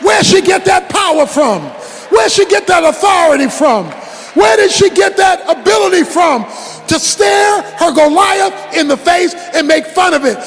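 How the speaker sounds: forceful preaching. Acted. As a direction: preach it hard to the congregation.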